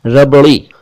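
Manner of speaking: रबड़ी with a flapped ड़ before the i; correct flap. Shown as a demonstration. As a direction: flick the ड़ in रबड़ी. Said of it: "रबड़ी नहीं कहें".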